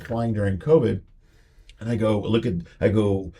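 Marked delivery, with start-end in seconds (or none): none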